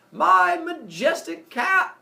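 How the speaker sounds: very high, loud slow wail; Apollo's cry. Acted. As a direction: wail for the lost cow. Apollo cries out.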